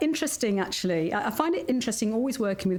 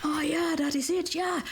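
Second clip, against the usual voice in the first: higher, hammed-up Dutch-accented English; retold Dutch client; 7 semitones higher than usual. Dutch clients agreeing with her, in a mock Dutch accent. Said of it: "And they went".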